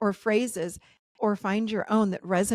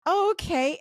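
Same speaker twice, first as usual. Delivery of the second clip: wobbly, dazed reply, pitched up; 9 semitones higher, 5 dB louder than usual. Retold or imitated. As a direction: imitate answering in a wobbly, dissociated voice. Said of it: "And it's, like, wobbly".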